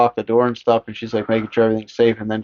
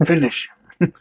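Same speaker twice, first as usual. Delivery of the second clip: game announcer; 5 semitones higher than usual. Pretend. Video game announcer joke.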